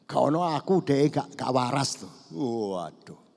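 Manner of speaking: ordinary delivery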